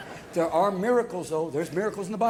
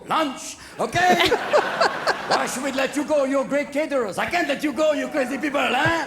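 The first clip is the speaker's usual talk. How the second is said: put-on raised, comic French accent; 4 semitones higher, 4 dB louder than usual. French refusing to free their caterers, comic French accent. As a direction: play an indignant Frenchman with a thick accent. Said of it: "His French accent was killing me".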